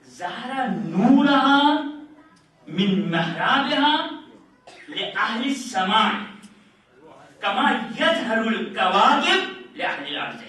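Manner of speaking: slow, drawn-out Arabic recitation; measured, sonorous quoting of scripture; Arabic hadith recitation. Real, not acted. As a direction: recite it solemnly, stretching the long vowels.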